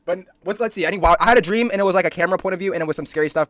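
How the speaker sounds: loud, high, rushing; keen to share his scary dream; excited. Real, not acted. Streamer telling viewers about a dream, loud and eager.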